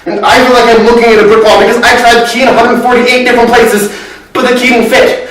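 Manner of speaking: high, worked-up, loud outpouring; agitated and insistent, pitched high; frantic recounting. Acted. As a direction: reel off the list, growing desperate. Acted frantic list of failed searches.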